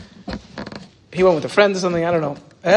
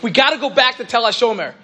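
raised, pressing voice imitating Aaron; urgent plea; 7 semitones higher than usual. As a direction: push it like an eager student begging. Aaron urgently quoted on phone.

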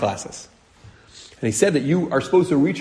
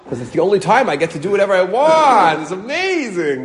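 gleeful relief; 6 semitones higher, 3 dB louder than usual. Real, not acted. Man confessing joy at messy hotel stay, gleeful and high. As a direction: revel in the freedom, higher and higher. Relishing escape from keeping clean.

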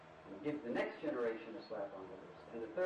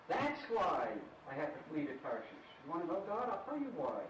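normal talking voice

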